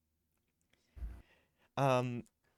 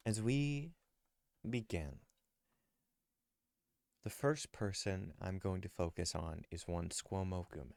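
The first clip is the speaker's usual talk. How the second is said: story narration; 3 semitones lower, 8 dB quieter than usual. Acted. GM narrates Squomo's scene.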